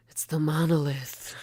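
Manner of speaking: hushed mock wonder; imitating a stunned discovery; gasping awe. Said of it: "he gasps and goes".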